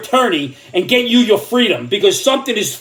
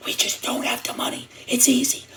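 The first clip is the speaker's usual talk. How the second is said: breathy hushed whisper of Joe; imitating Joe whispering the excuse; mock whisper; 12 dB quieter than usual. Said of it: "And he whispered".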